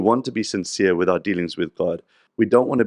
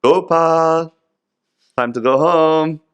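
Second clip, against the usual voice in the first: higher, louder, slow call through the door; Narad Muni calling; 8 semitones higher, 6 dB louder, much slower than usual. Retold or imitated. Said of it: "He's knocking on the door".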